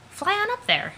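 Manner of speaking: high, loud cheering; excited. Acted. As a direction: cheer them upward. Cheering on the flight.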